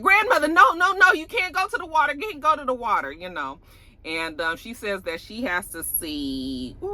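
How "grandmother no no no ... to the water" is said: high, pleading cries of no; begging grandma not to go; frantic pleading grandchild. Retold or imitated. Grandchild begging grandmother away from the water, high pleading.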